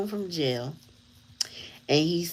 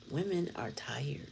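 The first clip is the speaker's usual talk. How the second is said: whisper; 10 dB quieter than usual. Real, not acted. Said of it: "weary aside".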